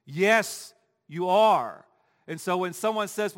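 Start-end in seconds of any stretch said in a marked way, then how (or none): 0.0-1.9 loud, emphatic contradiction; punchy retort with pauses between phrases; standing firm. Shown as a demonstration. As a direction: stand up and flatly refuse to agree. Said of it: "stand up and say".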